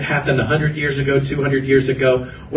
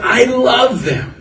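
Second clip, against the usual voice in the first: imitating a preacher's fervor, high, loud and drawn out; slow, raised-voice retold declaration of love; fervent passion; 8 semitones higher, 5 dB louder, slower than usual. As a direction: cry it out with passionate conviction. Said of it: "he said it with this fervor and this passion".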